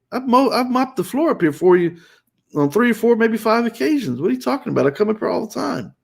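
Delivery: high-pitched indignant protest, retold; acting out an aggrieved comeback. Retold defensive reply to wife.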